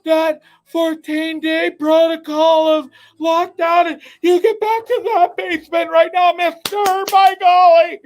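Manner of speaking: high, slow scandalized scold; scolding friends impression. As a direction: mimic shrill outraged friends. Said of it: "I gotta stop the screaming".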